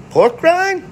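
Pork rind? high rising question; quizzical Pork rind. Retold or imitated. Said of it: "he goes".

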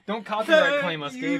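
loud, high protest; shouting. Real, not acted. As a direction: yell it out to stop them. Stops the singing.

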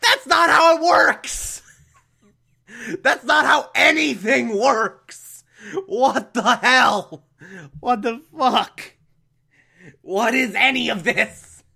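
high, slow yell breaking into laughter; incredulous, high-pitched rant through laughs; laughing exasperation. Real, not acted. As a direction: cry out in baffled protest, laughing. Exasperated outburst at absurd plot.

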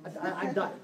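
quiet, laughing confiding voice; mock complaining in a low murmur; hushed gossip. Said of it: "You gotta go tell Catherine".

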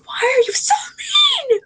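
high-pitched drawn-out yell; retold yell. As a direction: yell it in a mock-outraged screech, stretched out. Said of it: "like, yell".

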